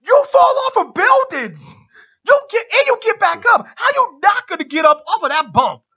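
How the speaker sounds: high, loud furious rant; imitated scream. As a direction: scream at the ref like a villain. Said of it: "Owens then screams at Shane".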